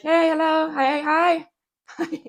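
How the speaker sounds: high, loud, eager hellos; mock eager greeting.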